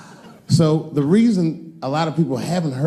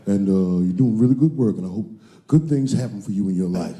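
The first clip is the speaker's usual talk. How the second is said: deep, smooth, friendly low voice; O.J. Simpson impression; 9 semitones lower than usual. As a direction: lay on a deep, easy charm. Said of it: "He's like".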